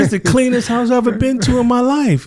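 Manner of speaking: an impressed workman, mimicked high; amazed exterminator. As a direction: gush like an astonished inspector. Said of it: "He said".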